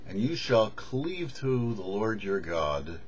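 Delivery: ordinary delivery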